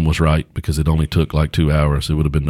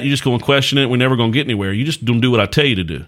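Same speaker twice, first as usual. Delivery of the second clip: usual speaking voice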